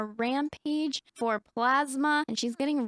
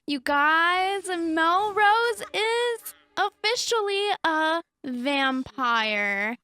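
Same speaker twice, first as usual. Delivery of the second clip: higher and louder, slowing down; breathless slow reveal to viewers; excited announcement; 5 semitones higher, 4 dB louder, much slower than usual. Real, not acted. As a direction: build suspense for the big reveal. Announcing she is a vampire.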